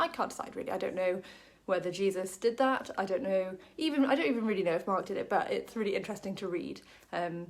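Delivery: normal talking voice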